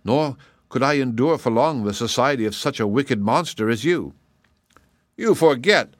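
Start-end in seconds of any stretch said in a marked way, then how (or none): 5.2-5.9 sharp angry retort. A narrator's reading. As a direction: snap back at her, stung. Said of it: "retorted the king".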